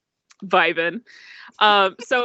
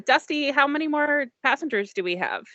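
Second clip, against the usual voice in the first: attendant addressing robot; 6 semitones higher than usual. Acted. Attendant questions his robot.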